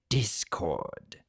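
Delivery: low, dark call of a name; Tirek voice. Acted. Tirek calling Discord back from his cell, low and grim.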